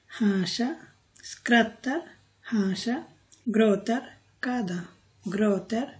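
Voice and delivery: normal talking voice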